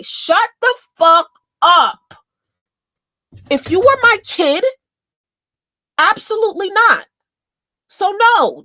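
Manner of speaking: high, loud, furious outburst; angry yell. Real, not acted. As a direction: yell it in disgust. Ranting at song she hates.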